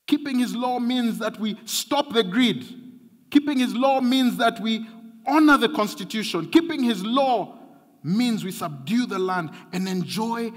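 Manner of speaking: rising preacher's cry to the church; preaching. Acted.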